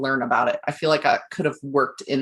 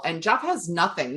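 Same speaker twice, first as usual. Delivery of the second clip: normal talking voice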